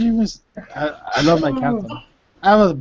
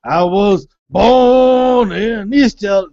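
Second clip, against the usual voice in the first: slow, drawn-out imitation of Issa Rae's LA accent; mock her slow LA drawl; lightly imitated Issa Rae LA accent; 3 semitones higher, 3 dB louder, much slower than usual. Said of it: "the real Issa Rae accent is like".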